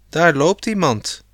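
understated storybook shout; sudden call. A narrator's reading. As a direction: call out a sudden sighting, lightly. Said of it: "riep Rob opeens".

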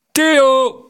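loud, high shout of a name; captain yelling below decks; bellowed summons. A narrator's reading. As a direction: roar for your crewman, pitch way up. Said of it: "brulde de kapitein".

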